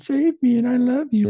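high, loud falsetto begging; over-the-top panicky squeal; mock panicked plea. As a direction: ham up a desperate woman pleading for her life. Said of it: "she's, like, panicking".

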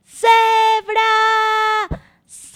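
loud high call to the zebra. Acted. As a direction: call the zebra across the plain. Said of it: "Ayúdenme a llamarla".

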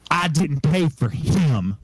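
slow, emphatic refusal, every word set apart; clear, deliberate. Retold or imitated. Upset patron rejecting her tour driver to his colleague, slow and deliberate. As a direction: spell it out slowly and clearly. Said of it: "and says, clearly".

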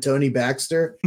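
usual speaking voice

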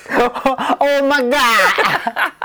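slow drawn-out laughing exclamation; Oh my God through real laughter. Real, not acted. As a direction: stretch it out while laughing. Laughs at the story.

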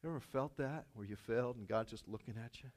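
normal talking voice

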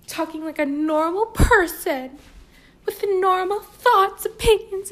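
high, loud, exaggerated posh-ish voice; mock grand voice, not her real one; put-on voice. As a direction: overdo a self-satisfied voice. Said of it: "that's not how I sound".

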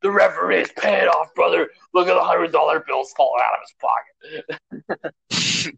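high, loud hammed-up wrestler promo; Hulk Hogan rant. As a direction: rant at Mean Gene like an outraged champ. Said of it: "He's like".

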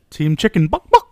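high loud clucking buck buck; chicken clucking. Pretend.

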